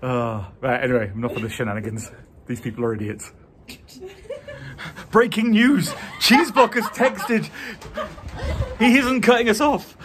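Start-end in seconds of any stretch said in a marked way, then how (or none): 5.1-10.0 mock breaking news. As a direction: announce it like a laughing newsreader. Mock news announcement, laughing.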